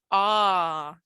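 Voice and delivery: long held AH, steady; sustained classroom AH; stretched out. Shown as a demonstration. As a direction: stretch AH out as a sound to copy. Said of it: "Stretch it out again".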